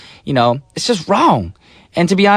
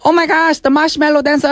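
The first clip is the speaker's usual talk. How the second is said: high excited fan voice; excited fan squeal; 12 semitones higher than usual. Retold or imitated. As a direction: gush like a starstruck fan. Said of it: "be like".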